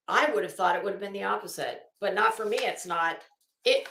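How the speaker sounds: normal talking voice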